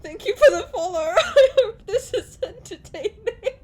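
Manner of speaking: real tearful laughter; laughing through tears. Real, not acted. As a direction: thank them while laughing and crying. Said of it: "laughing and crying".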